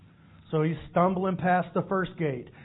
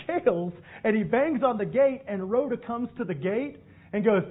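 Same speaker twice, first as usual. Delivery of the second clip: usual speaking voice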